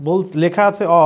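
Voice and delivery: ordinary talk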